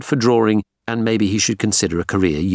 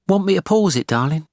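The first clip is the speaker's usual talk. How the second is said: higher, lightly voiced wife; a gentle wife's offer, pitched up; 11 semitones higher than usual. A narrator's reading. Natalie asks her husband about pausing the show, light wife voice.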